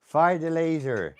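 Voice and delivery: drawn-out, high order; slow commanding. Shown as a demonstration. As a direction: spell it out for the bird. Commanding parrot.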